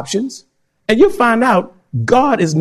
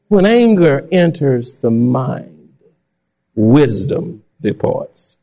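slow low repeat; 8 semitones lower, much slower than usual. Shown as a demonstration.

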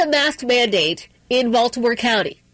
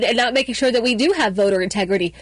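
ordinary talk

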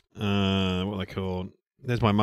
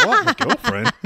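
high mock disbelief; squeaky startled reply; surprised; 14 semitones higher than usual. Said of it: "I'm like".

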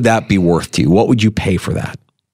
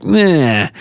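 higher, drawn-out noise of indifference; dismissive meh; 6 semitones higher than usual. Real, not acted. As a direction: shrug it off with a meh. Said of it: "everything else is like".

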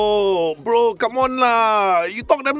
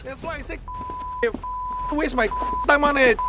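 walking away; 6 dB quieter than usual. Acted. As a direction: voice fading off into the distance. Guest storming off, voice receding.

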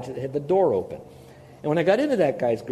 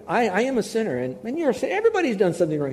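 insistent; 7 semitones higher, faster than usual. Retold or imitated. Insists to the man.

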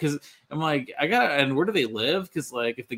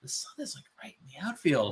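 breathy hushed aside; whisper; 7 dB quieter, faster than usual. Retold or imitated. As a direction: whisper it like a secret. Retold thought, whispered.

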